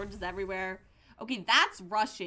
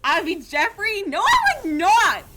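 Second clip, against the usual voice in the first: loud, high, indignant denial; mock outrage; 8 semitones higher, 11 dB louder than usual. Streamer refusing chat's character pick, loudly indignant. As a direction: protest hard against the insult.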